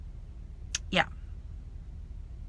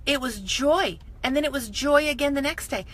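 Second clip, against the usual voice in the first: usual speaking voice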